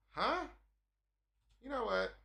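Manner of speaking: loud, high indignant huh; offended outburst. Retold or imitated. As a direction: bristle at the insult. Offended reaction.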